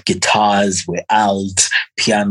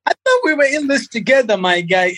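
high, fast pretend wounded plea; mock hurt whine. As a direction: whine like a jilted friend, laughing. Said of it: "I'm like".

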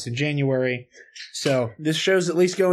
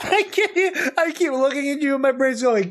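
high voice full of laughter; barely holding the laugh back; cracking up; 10 semitones higher than usual. Real, not acted. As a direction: talk through real giggles. Cracking up at co-host's slip.

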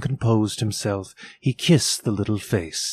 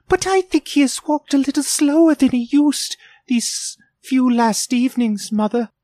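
put-on much higher, louder boy; young boy's voice; 5 dB louder than usual. A narrator's reading. Peter to his mother about his father, high boyish voice. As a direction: speak up as the worried eldest son.

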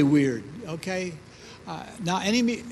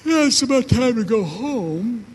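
flat, mildly awkward exit line; bored friends; 5 semitones higher, 4 dB louder than usual. Retold or imitated. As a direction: friends after the Bible reading, mildly awkward. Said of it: "my friends went".

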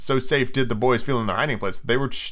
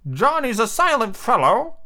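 high, slow pirate henchman; high-pitched sidekick; Smee; 11 semitones higher, slower than usual. Acted. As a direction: remind the captain in a high, drawn-out voice. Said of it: "reminded Hook".

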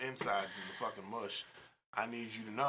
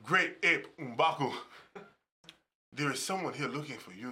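put-on African coworker's accent. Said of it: "He'll walk through the door".

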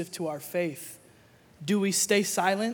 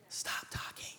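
whispered hush; soft whisper telling him to stop talking; 12 dB quieter, slower than usual. Retold or imitated. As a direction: breathe it with no voice. God hushing talkative pray-er.

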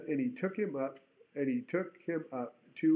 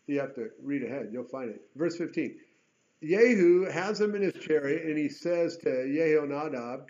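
ordinary talk, nothing marked

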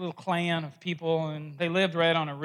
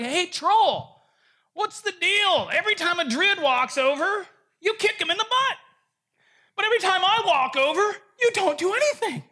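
rabbi calls down to the troll, higher and louder; rabbi calling troll; 11 semitones higher, 4 dB louder than usual. Acted. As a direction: lean over the rail and call out to the troll, high and loud. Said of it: "he's like".

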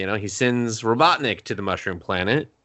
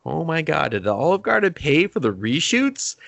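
mock disbelief; 6 semitones higher than usual. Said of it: "I was just like".

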